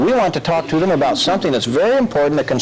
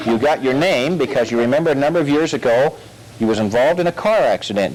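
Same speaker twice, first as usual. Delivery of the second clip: ordinary delivery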